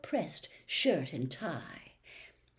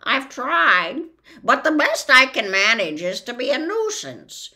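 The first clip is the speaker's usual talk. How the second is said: loud, higher sorry bird; rueful bird; 6 semitones higher, 9 dB louder than usual. Acted. The bird admits to Milo he is only a nuisance. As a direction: confess your small ambition.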